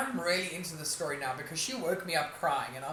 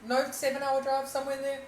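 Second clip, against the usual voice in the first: normal talking voice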